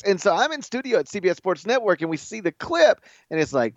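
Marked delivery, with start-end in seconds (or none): none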